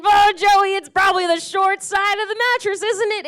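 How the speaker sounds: a lightly voiced smug audience member; mock audience voice. Comic imitating the crowd's doubt about her hips, mock smug.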